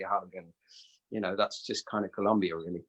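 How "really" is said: ordinary delivery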